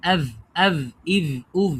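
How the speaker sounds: আভ said with open air, ব turned into ভ like English v; wrong airy v sound. Shown as a demonstration. Teacher demos the wrong airy ব for students. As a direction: demo the wrong breathy আভ, ইভ, উভ. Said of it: "আপনি এরকম বলতে পারবেন না".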